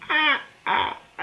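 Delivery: high-pitched mock Joker cackle; spaced-out "ah" bursts, a put-on laugh; imitated villain laugh. Vlogger imitating the Joker's laugh from the trailer, mock cackle. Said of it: "the, the laugh is pretty good".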